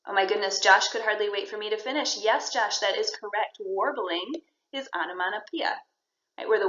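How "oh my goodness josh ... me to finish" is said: ordinary talk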